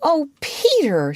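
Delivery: high, drawn-out longing call; eager girl's exclamation; excited cry. A narrator's reading. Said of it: "she cried".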